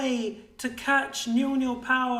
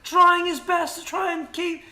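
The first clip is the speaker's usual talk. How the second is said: fast, raised, urgent fight calling; live play-by-play; 6 semitones higher, 4 dB louder than usual. Real, not acted. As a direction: call the fight as it unfolds. Live team fight commentary.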